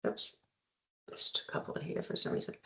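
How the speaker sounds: under-the-breath whisper; whispered aside. Real, not acted. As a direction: whisper it to yourself, off mic. Muttered aside during screen trouble.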